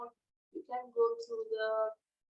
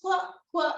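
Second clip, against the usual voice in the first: high qu- quacks; duck quacking; 6 semitones higher, 5 dB louder than usual. Shown as a demonstration. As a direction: mimic a duck. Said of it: "like the duck quacking".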